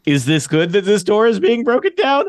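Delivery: high-pitched, unsure guard voice; bewildered guard. Acted. Guard watches door collapse, high bewildered questioning. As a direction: ask in a high voice, at a loss.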